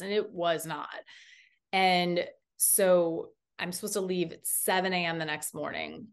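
ordinary delivery

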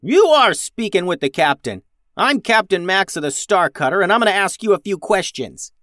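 put-on high, loud young captain; yelling. A narrator's reading. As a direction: yell to assert command. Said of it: "yelled Max".

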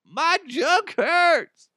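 high, loud, slow whine of pain; whimpering man hurting; mock moaning patient. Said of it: "he's just like".

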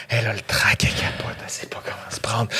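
breathy whisper, voice dropped away. A narrator's reading. Telling of the actress's stage fright in a whisper. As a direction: whisper it like a secret backstage.

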